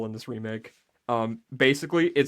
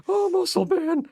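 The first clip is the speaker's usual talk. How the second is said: high, slow breathy cartoon ghost; High Five Ghost impression; 3 dB louder, slower than usual. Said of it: "That's my high five ghost impression".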